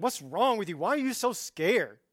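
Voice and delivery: hammed-up impatient telling-off; mock scolding. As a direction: berate them for being scared. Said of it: "He doesn't go in there and is like".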